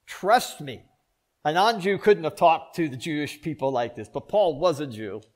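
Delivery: preaching loud. Acted. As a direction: hammer it home to the pews. Preacher driving a point home.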